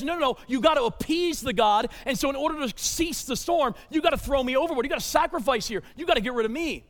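a preacher voicing Jonah with a loud, high shout; Jonah insisting. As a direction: loudly urge the sailors to throw him over. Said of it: "He says".

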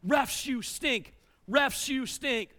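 slow, stretched-out stadium chant; mock crowd yell. Crowd across the arena at the referees, mock yelled chant. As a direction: imitate a whole arena chanting, without full force. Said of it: "entire arena is yelling".

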